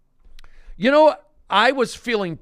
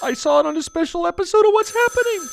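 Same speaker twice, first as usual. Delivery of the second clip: put-on panicked kid; 14 semitones higher, 5 dB louder, faster than usual.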